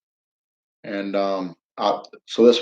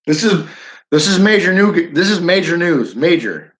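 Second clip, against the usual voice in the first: excited mock news alert, higher and louder; hammed-up anchor; 5 semitones higher, 4 dB louder than usual. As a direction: oversell the big story like a TV anchor. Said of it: "breaking news".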